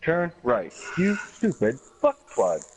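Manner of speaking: sat-nav voice turning rude, slow; deadpan navigation voice with an insult; mock GPS snapping at the driver. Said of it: "I said".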